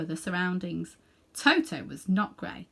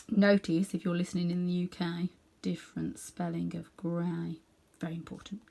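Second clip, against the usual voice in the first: quiet aside; 3 semitones lower, 7 dB quieter than usual. Real, not acted. Narrator's joking aside about spelling.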